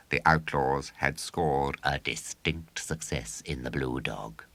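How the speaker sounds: ordinary delivery, nothing marked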